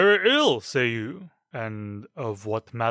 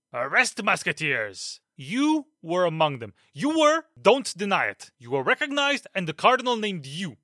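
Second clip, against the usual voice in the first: furious accusation. Acted. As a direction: blast them, don't let them deny it. Captain furiously accuses musketeers.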